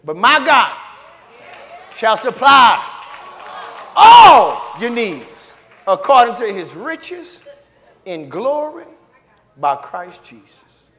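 shouted, slowed scripture; roaring preacher reading the promise; preaching at full force. Acted. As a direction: thunder out the verse to the church.

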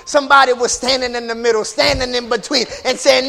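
hoarse chanted sermon, loud and high; whooping preacher. Acted. Preacher whooping to the congregation, loud and high.

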